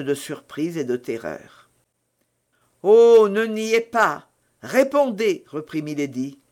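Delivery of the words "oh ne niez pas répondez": louder, higher, drawn-out urging; insistent demand. A narrator's reading. Milady demands the novice admit who she is, insistent. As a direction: press hard for the answer.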